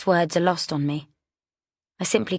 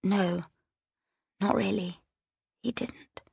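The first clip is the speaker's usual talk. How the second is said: hushed, much softer half-whisper; barely voiced, holding back the words; 3 semitones higher, 6 dB quieter than usual. A narrator's reading. Daughter half-whispering her denial to her mother. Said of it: "she half whispers".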